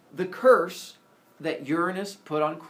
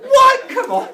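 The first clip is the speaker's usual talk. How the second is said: very high, loud indignant yelp; mock protest; 17 dB louder, slower than usual. Kronos protesting after eating baby, high loud mock indignation. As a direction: squawk it as if wrongly blamed.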